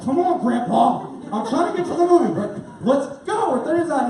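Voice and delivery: high, exasperated hurrying with a laugh; impatient. Retold or imitated. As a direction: hurry along a dawdling ticket guy. Impatient at slow ticket man.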